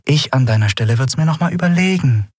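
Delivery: sweet as sugar, lightly threatening. A narrator's reading. Woman advising her captor to reconsider, sugar-sweet. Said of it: "sagte sie zuckersüß".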